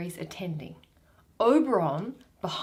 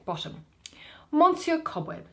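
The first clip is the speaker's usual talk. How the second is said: ordinary delivery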